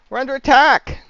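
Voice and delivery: loud high alarmed shout; yelling alarm. Acted. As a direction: raise the alarm at full voice. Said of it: "he yells".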